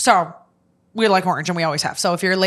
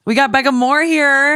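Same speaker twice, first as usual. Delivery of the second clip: excited announcement; 6 semitones higher, 6 dB louder than usual. Real, not acted. Announcing the guest.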